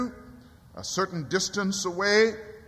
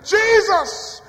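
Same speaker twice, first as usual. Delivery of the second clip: high, louder plea; crying out; 10 semitones higher, 3 dB louder than usual. Retold or imitated. Preacher crying out his own prayer, high plea. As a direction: call his name from the heart. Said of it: "I prayed it".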